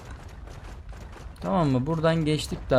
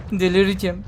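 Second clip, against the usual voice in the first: exasperated outburst; 6 semitones higher, 5 dB louder than usual. Real, not acted. Lost in maze, exasperated.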